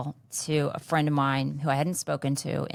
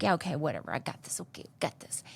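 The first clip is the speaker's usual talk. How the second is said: whispered, brushing it off; dismissive whisper; 6 dB quieter, faster than usual. Retold or imitated. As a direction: mutter it off like you don't care. Her own dismissive younger self.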